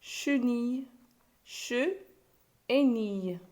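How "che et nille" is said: "che", a pause, then "et nille"; teacher isolating "che" and "nille"; split into syllables. Shown as a demonstration. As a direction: break chenille into two separate beats, "che... et nille". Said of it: "On a trouvé deux syllabes".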